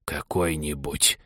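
low, slow, soft huffy echo; indignant wheeze. A narrator's reading. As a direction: snuffle it back slowly, offended. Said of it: "возмущённо просопел Соня".

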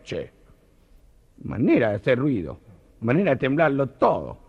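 put-on Argentine accent. Parrot furious at the shunting trains, Argentine accent. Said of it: "contarlos con acento argentino".

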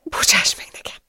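tearful, breath-only apology; whispered plea. A narrator's reading. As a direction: whisper it, begging forgiveness. (suttogó, sírós bocsánatkérés)

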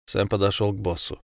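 ordinary delivery, nothing marked

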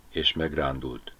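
usual speaking voice

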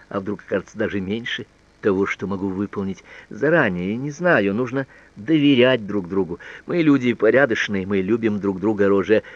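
plain reading voice

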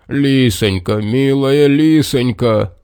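slow, wailing lament; a big bear keening, slowed down. A narrator's reading. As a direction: drag out the grief over the fox. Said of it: "запричитал медведь".

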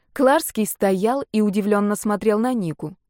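ordinary delivery, nothing marked

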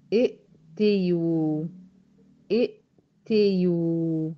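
ائتيو drawn out very slowly, every sound spelled out, with the ي kept before the و; slow pronunciation demo. Shown as a demonstration. Teacher slowly models ائتيو for students, clear and drawn out. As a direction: stretch out ائتيو slowly for the class. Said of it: "المفروض إن هي تبقى إيه؟".

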